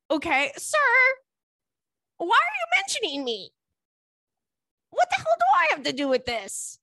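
can't believe he brought her up; outraged disbelief. Real, not acted. Reacting to the ex mentioning her, high indignant disbelief.

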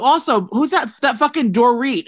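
ordinary talk

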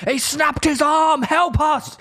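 high, slow, loud hammed-up call for help; mock panicked plea. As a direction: burst into the hospital calling for help. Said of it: "I go".

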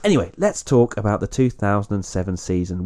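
usual speaking voice

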